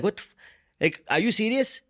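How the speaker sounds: mock outrage at the brother, voice jumping up; pissed off. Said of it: "I would get so pissed".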